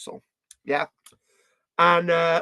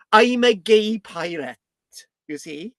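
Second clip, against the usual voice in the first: Welsh pirate; 4 dB louder, slower than usual. Acted. Pirate telling people who he is, hammed-up Welsh accent. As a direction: announce it in a broad Welsh lilt. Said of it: "I'm a Welsh pirate".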